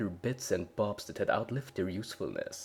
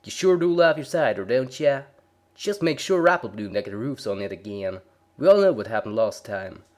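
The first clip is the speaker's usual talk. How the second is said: loud, playful twang; teasing country girl; 3 semitones higher, 9 dB louder than usual. A narrator's reading. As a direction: rib him fondly. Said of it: "Applejack laughed".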